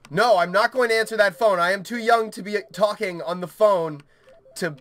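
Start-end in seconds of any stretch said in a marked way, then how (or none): none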